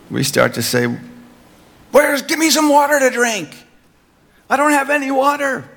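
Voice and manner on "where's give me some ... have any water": much higher, whining for water; mock the grumbling believer; whiny complainer.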